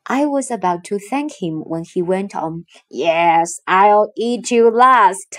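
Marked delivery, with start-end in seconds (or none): 2.9-5.3 slow, louder menacing giant; gloating Cyclops. Acted. Cyclops taunting Odysseus, slow gloating menace. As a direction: savour the threat slowly.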